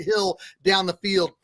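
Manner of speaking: ordinary talk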